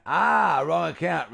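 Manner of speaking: a sudden penny-drop exclamation; realising. Real, not acted. Realising the phrase was "account": loud penny-drop exclamation. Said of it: "Not wrong accent".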